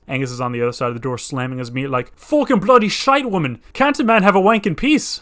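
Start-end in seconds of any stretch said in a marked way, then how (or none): 2.2-5.2 louder, higher hammed-up Irish accent. Angus yells through the door in a hammed-up Irish accent. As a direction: bluster in an overdone Irish accent. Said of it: "This is an Irish accent".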